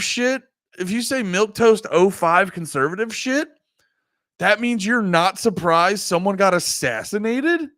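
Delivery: retelling his answer to a comedian, indignant; retold retort. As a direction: fire it back in disbelief. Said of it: "I go".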